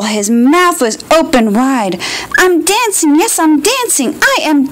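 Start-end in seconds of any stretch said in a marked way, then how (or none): 2.4-4.7 higher, delighted exclaiming; joyful cry. Acted. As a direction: cry out with pure joy. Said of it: "Gerald cried".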